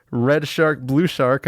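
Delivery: ordinary delivery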